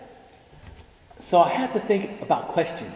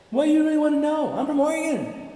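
high, pointed reply; imitating the psychologist; 12 semitones higher than usual. Dr. Sue answering the nosy asker, high and pointed. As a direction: turn the question back lightly. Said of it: "He'd say".

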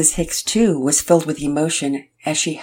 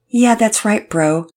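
surfer bro voice; 6 semitones higher, 5 dB louder, slower than usual. Acted. Todd's bro voice in story.

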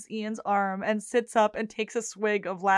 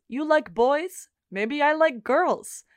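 slow, teasing Svetlana; 5 semitones higher, slower than usual. Retold or imitated. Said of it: "she says".

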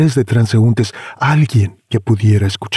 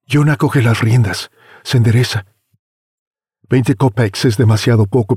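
ordinary delivery, nothing marked

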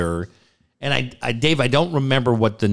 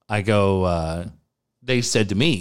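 ordinary delivery

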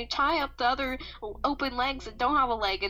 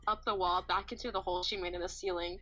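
ordinary talk, nothing marked

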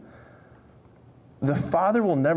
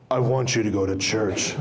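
low, looming Darth Vader style voice; deep booming God voice; 6 semitones lower than usual. Pretend. Preacher mocks a scary God voice, deep and booming. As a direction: boom it out like a movie villain. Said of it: "God's voice was something like Darth Vader".

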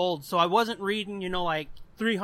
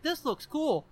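put-on impressed kid; 6 semitones higher, 4 dB quieter than usual. Acts his childhood reaction to book.

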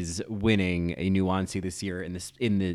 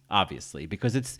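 normal talking voice

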